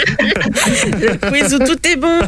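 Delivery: drawn out through laughter; laughing. Real, not acted. Panelist teases the host about the quiz, laughing. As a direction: laugh while getting the words out.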